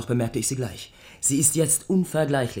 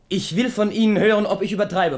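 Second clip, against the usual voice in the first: higher and louder, pressing eagerly; eager urging; 7 semitones higher, 7 dB louder than usual. Acted. Courtier eagerly urging the king to come, higher and louder. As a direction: sell it with excitement.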